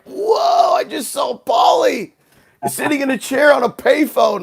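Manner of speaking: shouting to his cousin, amazed at a star sighting; starstruck shout. Retold or imitated. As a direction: blurt it out thrilled.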